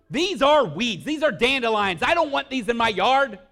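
mock-outraged shout, "These are weeds"; hammed-up shouting dad. Dad scolds daughters over dandelions.

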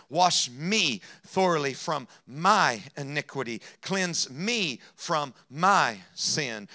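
slow, weighty psalm reading; scripture reading. Acted. Preacher reading David's prayer to the church, slow and solemn. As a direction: lay each plea out slowly. Reads Psalm fifty-one aloud.

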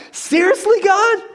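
loud, high-pitched disbelieving complaint to God; acted outburst of indignant frustration; exasperated protest. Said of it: "he has got to be like".

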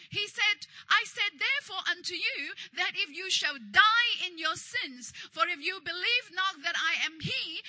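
loud, driving sermon delivery; forceful scripture preaching. Real, not acted. Preacher voices Jesus's words to congregation, forceful preaching. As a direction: declaim the verse with steady force.

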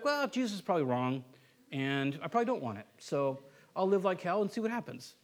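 low, quiet dismissiveness; mock pompous. Believer doubting what Jesus says, mock pompous. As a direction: casually brush it off, softly. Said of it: "so pompous to be like".

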